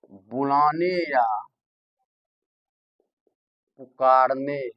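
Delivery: slow, spaced-out words; slow dictation. Shown as a demonstration. Teacher dictating the use of pluta to the class, slow. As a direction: dictate each phrase with pauses.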